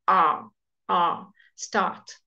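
R called out loud, then start with no R; name the R loud, then drop it in start; r letter then start. Shown as a demonstration. Teacher contrasting the letter R with British start. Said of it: "you don't pronounce the R".

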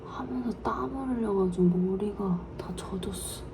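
whispered cooking vlog narration; hushed so he won't wake; whispering. Real, not acted. (몰래 속삭이는 목소리)

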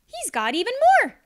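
high, loud and fast; excited hare. Acted. Hare excitedly announcing Badger's arrival, high and quick. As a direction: rush it out with glee.

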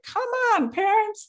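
high impatient come on; exasperated. Retold or imitated. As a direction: roll your eyes and whine at the ringing phone. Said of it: "rolling my eyes".

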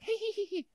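imitating children's high-pitched, soft giggle; mock giggle of ten-year-olds; kids giggling. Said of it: "and they're like".

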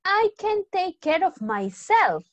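high, slow, defiant; teacher voicing Emma's cheeky comeback to Steve; sassy reply. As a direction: talk back like a stubborn teen. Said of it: "Emma responde mal".

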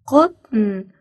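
usual speaking voice

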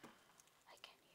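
soft breathy whisper, half to himself; whispered aside. Real, not acted. Teasing the viewers who can't answer, in a whisper. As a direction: toss the joke off under the breath.

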